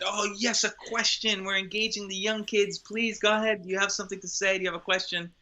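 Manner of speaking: high-pitched, delighted and encouraging; eager performers addressing a child. Retold or imitated. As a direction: coo an invitation to a small child. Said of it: "We said".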